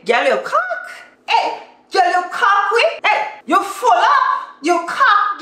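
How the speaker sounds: high-pitched Grenadian teasing; Grenadian accent. Acted. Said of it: "Grenadians would say".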